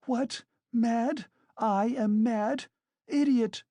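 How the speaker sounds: very high-pitched incredulous fury; indignant woman rising to a piercing retort; outraged shriek. A narrator's reading. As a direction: shriek back at the insult. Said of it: "shrieked Katerina Ivanovna".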